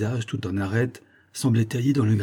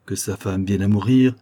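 plain reading voice

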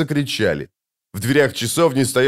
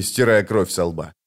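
ordinary delivery, nothing marked

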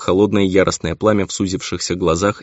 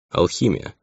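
understated, aloof one-word answer; coolly dismissive; haughty indifference. A narrator's reading. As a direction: answer as if it hardly matters. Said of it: "ответил он всё с тем же высокомерно равнодушным".

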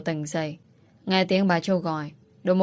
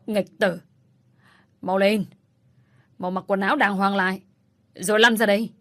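rising furious command to a disgraced son; angry and ashamed. A narrator's reading. Father ordering his disgraced son out of bed, angry rising command. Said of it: "Ông Cao vừa tức lại vừa thẹn".

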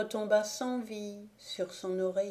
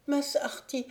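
put-on higher feverish child's voice, a falling call; delirious call; 6 semitones higher than usual. A narrator's reading. Sick boy calls his master in delirium, falling call. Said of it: "Puis il continua".

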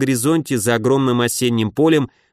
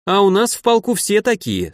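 higher-pitched, proud cadet's reply; self-important; 7 semitones higher than usual. A narrator's reading. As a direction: answer with a show of importance. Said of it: "солидно отвечал им юнкер". (солидно, с гордостью)

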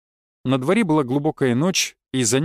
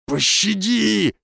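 high, drawn-out cry for mercy; pleading; 6 semitones higher, much slower than usual. A narrator's reading. Stranger begs the hero for mercy, pleading. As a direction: beg with the word stretched out. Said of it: "первым взмолился незнакомец".

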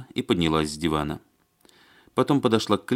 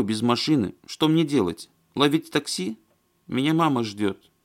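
high-voiced, aggrieved woman character; Маня; 7 semitones higher, 3 dB louder than usual. A narrator's reading. Woman stranded without car or taxi, complaining plaintively. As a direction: pour out the complaint in a higher voice.

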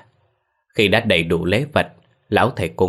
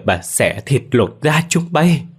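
grieving threat; 6 semitones higher, 4 dB louder, slower than usual. A narrator's reading. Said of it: "Tiếng gào khóc của bà mẹ già".

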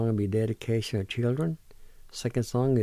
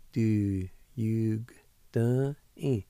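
ordinary talk

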